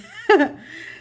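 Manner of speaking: short, high-pitched giggle; a bright little laugh. Real, not acted. Amused by the goofy card.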